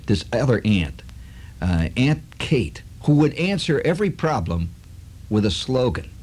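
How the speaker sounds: usual speaking voice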